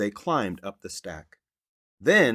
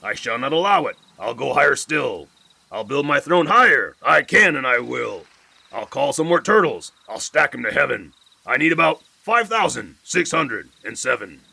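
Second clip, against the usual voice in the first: louder, indignant king; jealous king Yertle; 3 semitones higher, 5 dB louder than usual. Acted. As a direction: vain king outraged at the moon. Yertle rages at the moon.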